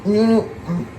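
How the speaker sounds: talking with a mouthful; mumbling through food; mouth full. Real, not acted. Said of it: "I can't talk with food in my mouth".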